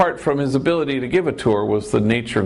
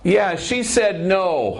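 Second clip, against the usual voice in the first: sheepish admission; 7 semitones higher, much slower than usual. Retold or imitated. Confessing the officer said no.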